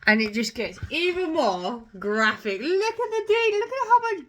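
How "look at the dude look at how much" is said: high excited exclaim; pointing out the gory picture; amazed. Real, not acted. Showing viewers a gory drawing, thrilled and high.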